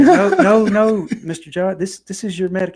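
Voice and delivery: high, loud, flustered correction; flustered nurse. Retold or imitated. Nurse correcting the joking grandfather, high and flustered. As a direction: play the nurse hurriedly protesting. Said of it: "And she's like".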